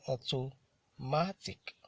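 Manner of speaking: "automatic" drawn out slowly in separated chunks; syllable by syllable. Shown as a demonstration. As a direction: sound out "automatic" for a class, very slowly, with gaps. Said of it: "That's another three-syllable word".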